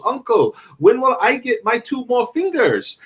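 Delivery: put-on high-pitched child alien in broken English; Zamus. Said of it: "that's how he talks. He talks very broken English".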